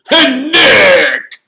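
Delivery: loud, high hearty holler; roaring Sir Patrick. A narrator's reading. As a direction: roar a jovial greeting. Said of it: "he roared".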